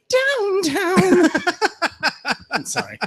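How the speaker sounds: a joke that collapses into laughing. Real, not acted. Joking intro cut off by his own laughter.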